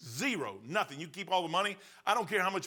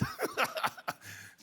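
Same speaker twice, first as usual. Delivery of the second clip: high laugh. Real, not acted. Laughing at own story.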